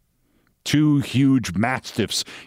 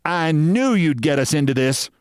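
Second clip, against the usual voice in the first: mildly voiced fury, raised pitch; higher, accusing scolding; furious; 6 semitones higher than usual. A narrator's reading. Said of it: "Sylvia was furious".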